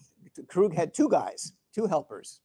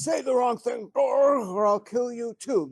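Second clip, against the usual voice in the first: higher, louder shouted threat with Dorg; angry shout; 8 semitones higher, 4 dB louder than usual. Retold or imitated. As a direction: bellow the threat at the underling. Klingon commander threatens his officer.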